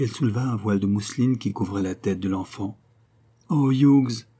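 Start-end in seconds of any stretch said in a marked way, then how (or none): 3.5-4.3 higher, louder exclamation of appeal; pleading outcry, narrated with restraint; crying out. A narrator's reading. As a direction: cry out his name, falling away. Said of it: "s'écria-t-elle".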